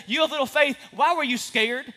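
"You of little faith" barked high and loud; mock scolding shout. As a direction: yell it like a harsh scolding master. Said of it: "Jesus does not shout at him".

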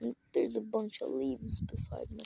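soft unvoiced whisper; ASMR whisper. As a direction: whisper close to the mic. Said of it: "I don't know if you liked my A S M R".

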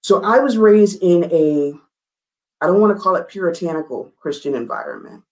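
ordinary talk, nothing marked

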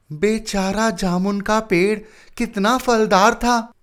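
lightly voiced clerk, high and slow; a hint of a wistful office clerk. A narrator's reading. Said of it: "एक clerk बोला".

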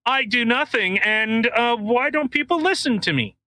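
high-pitched mocking impression; Russell Brand. Mocking a pundit nobody listens to, high imitated whine. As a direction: pitch it way up, a hammed-up complainer. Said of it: "that thing from Russell Brand".

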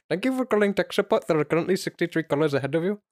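mock Indian tech support accent. Shown as a demonstration. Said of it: "half decent Indian".

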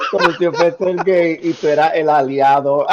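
loud, high, amused exclaiming; barely holding back laughter while talking; cracking up. Real, not acted. Cracking up over bar story. (hablando fuerte, muerto de risa)

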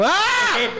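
high, strained "Ah!" of a pretend mute; hammed-up mute boy's cry; mock mute groan. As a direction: blurt a wordless high yelp.

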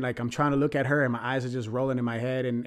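normal talking voice

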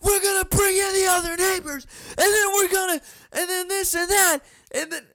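an imagined blow-up at the neighbor, going right over the top; mock screaming rant. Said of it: "hear myself going off".

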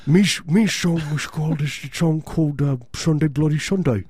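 slurred, mumbly mock-Irish accent. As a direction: put on a loose Irish brogue, slushy s sounds. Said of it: "That's my [name] impersona-, imp-, impre-, impressionation".

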